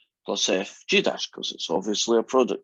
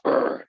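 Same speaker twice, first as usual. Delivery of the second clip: short rough "urgh" grunt; aggressive growl. Shown as a demonstration. Teacher showing how the sound should feel, aggressive growl. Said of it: "it's aggressive".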